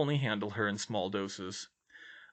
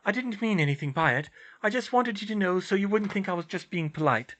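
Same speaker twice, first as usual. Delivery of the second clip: higher, louder character voice; flustered, pitched-up explaining; flustered heroine; 9 semitones higher, 3 dB louder than usual. Acted. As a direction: ramble on in embarrassment. Hermione keeps babbling.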